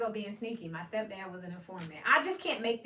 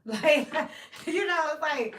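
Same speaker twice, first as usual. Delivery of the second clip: 1 laughing outburst; 6 semitones higher, 9 dB louder, slower than usual. Real, not acted. Laughing at the absurdity.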